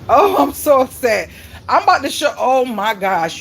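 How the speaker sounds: high, loud cry of exasperation; real loud, high-voiced dismay; upset outburst. Real, not acted.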